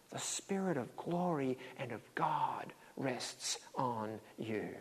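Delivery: slow hushed whisper; whispered blessing. Acted. Preacher whispering God's promise to the congregation. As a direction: let the promise fall to a whisper.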